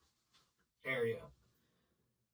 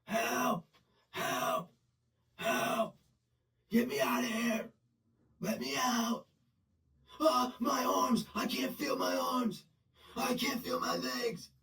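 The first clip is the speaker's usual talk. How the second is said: high panicked yelling, imitating inmates; hammed-up desperate cries, much higher; mock screaming for help; 10 semitones higher, slower than usual. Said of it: "a lot of dudes, that's all they do".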